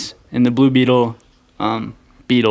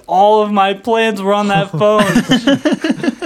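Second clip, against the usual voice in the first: high, slow, drawn-out mock lament; hammed-up villain despairing over ruined plans; mock villain outrage; 8 semitones higher, slower than usual. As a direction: imitate an exasperated villain's outburst. Villain's outburst over smashed phone, retold.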